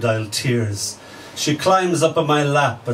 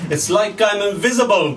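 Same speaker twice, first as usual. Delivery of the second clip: high, complaining cry; a lightly voiced whining colour; plaintive cry; 7 semitones higher than usual.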